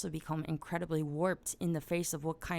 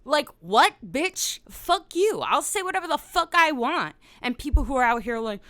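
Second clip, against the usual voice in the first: high, loud indignant retort; worked-up defiant outburst; defiant comeback; 7 semitones higher, 9 dB louder than usual. Acted. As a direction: fire back at the critics, voice raised. Acted quarrel with the critics.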